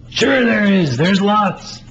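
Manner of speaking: high, loud, eager voice. Acted. Playwright voices the eager friend reassuring Melvin, high and loud. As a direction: answer like it's obvious, bursting with certainty.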